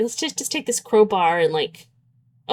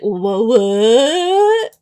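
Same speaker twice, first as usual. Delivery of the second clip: mock confusion; 4 dB louder than usual. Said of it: "now you're just like".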